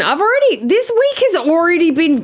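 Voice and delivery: high, whiny overwhelmed complaint; fed-up exasperation; exasperated. Real, not acted. Exasperated about cats.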